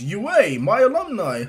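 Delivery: loud, high, delighted hero voice; All Might. Acted. Hero hearing of his old school, loud and high. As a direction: boom it with proud surprise. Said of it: "And he goes".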